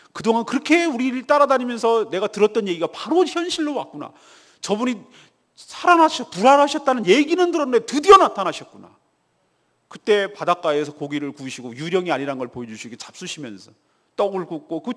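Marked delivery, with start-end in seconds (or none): 0.1-9.0 loud, swelling excitement as recognition dawns; amazed realization. Retold or imitated. Peter recognizing the risen Jesus, loud and amazed. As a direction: act out an awestruck disciple, voice rising.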